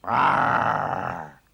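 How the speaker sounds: loud roaring growl from the lion. Acted. The lion roars at the constable, loud and fierce. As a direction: roar like an angry lion.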